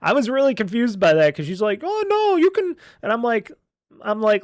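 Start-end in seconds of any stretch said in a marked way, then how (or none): 1.8-2.8 mimicking the lady. Lady's quick answer mimicked.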